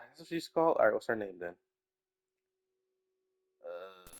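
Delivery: usual speaking voice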